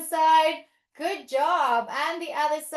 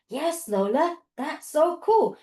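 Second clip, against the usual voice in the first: ordinary talk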